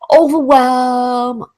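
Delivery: drawn-out, slow, stunned word; dazed overwhelm. Retold or imitated. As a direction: stretch it out as if swamped. Acting out being overwhelmed.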